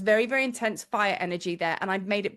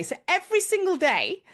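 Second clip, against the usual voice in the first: usual speaking voice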